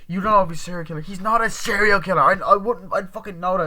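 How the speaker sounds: high, loud mock quarrelling; acted heated argument. Friends quarrel over a possible killer, mock heated argument.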